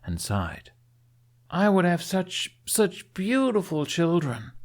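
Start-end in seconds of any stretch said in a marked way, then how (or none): 1.5-4.5 moaning. A narrator's reading. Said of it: "she moaned".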